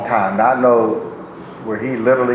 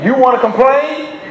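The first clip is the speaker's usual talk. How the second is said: preaching; 6 semitones higher than usual. Acted. Preacher urging the church not to complain, fervent preaching. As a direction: preach it up to the congregation.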